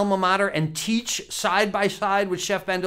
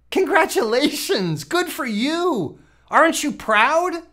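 mock congratulations; 7 semitones higher than usual. Mock praise to viewer.

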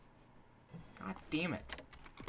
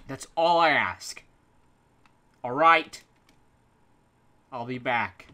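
ordinary talk, nothing marked

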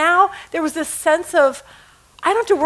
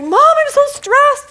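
high, loud mock-panicked wail; hammed-up stressed whine, pitched way up; imitated freak-out; 13 semitones higher, 6 dB louder, slower than usual. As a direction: drag it out as an overwrought son calling mom. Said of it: "He calls me totally freaking out".

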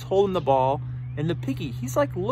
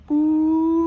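high, long gliding "boo"; sustained hoot tracing the ball upward; imitated ball flight; 6 semitones higher, 3 dB louder than usual. Piggy throws it up real high, imitated ball flight.

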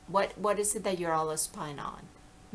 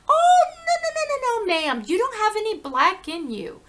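high, loud imitation of an indignant mother; rapid-fire no's, acting out mom's refusal; mom's shocked denial; 14 semitones higher, 9 dB louder than usual. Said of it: "And my mom said".

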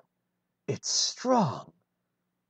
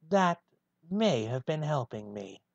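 ordinary delivery

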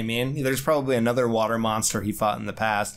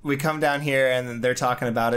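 ordinary talk

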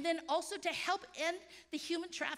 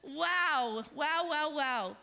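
excited wows; 5 dB louder, much slower than usual. Real, not acted. Speaker reacting with delight, loud excited wows. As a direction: exclaim with delight.

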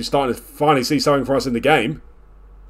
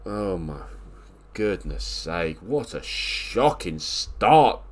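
weary groan of despair; 4 semitones lower, 5 dB quieter, much slower than usual. Real, not acted. Despairing at a bad match.

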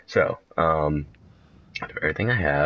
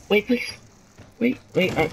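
ordinary delivery

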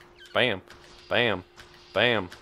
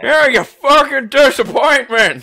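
angry dad yell; 13 semitones higher, 9 dB louder than usual. Acted. Voicing the abusive father.

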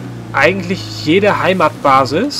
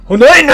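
sneeze; 9 semitones higher, 6 dB louder than usual. Real, not acted. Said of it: "ich muss niesen".

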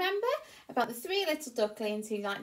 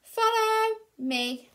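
high, slow cheerful duck voice; Dizzy Duck; 12 semitones higher, 6 dB louder, much slower than usual. Acted. Dizzy Duck inviting ducklings to swim, high and slow. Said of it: "Dizzy leads".

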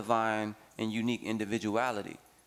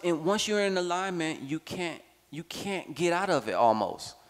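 normal talking voice

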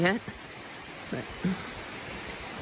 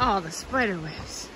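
usual speaking voice

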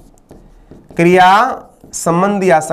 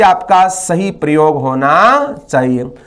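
ordinary delivery

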